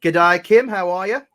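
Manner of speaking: loud, cheery Australian g'day; punchy mock-Australian greeting; put-on Australian accent. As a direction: hail them in a playful Australian accent. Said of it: "I could switch back to that".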